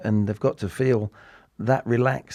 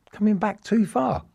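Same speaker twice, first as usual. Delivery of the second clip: amazed echo; 11 semitones higher than usual. Retold or imitated. Echoing the manager's surprising instruction.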